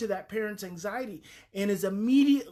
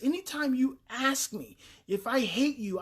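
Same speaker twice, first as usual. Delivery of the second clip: ordinary talk, nothing marked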